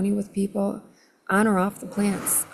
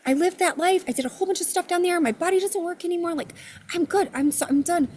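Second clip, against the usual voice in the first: higher, quick pleading to God; high rushed pleading; 10 semitones higher, faster than usual. Retold or imitated. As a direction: rattle off reasons, begging. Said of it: "And I'm like".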